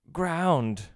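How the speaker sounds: Ground! called out high and loud like a cheer; Ground! bursting out, bright and emphatic; exclaimed. Shown as a demonstration. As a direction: shout Ground! with an exclamation point. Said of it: "With an exclamation point".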